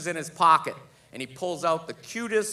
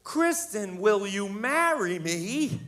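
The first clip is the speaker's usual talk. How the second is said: higher, slow put-on reading voice; reading a note aloud; 4 semitones higher, slower than usual. Kristen reading the proposal note aloud, slow reading. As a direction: imitate her reading out the words slowly, pitched up. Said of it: "read it in front of all of us".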